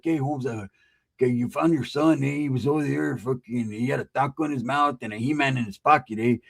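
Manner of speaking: lightly voiced barrio homie; a hint of an old-school Chicano gangster; retelling it as a street homie; OG norteño. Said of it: "he was an O G northerner".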